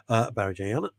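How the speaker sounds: normal talking voice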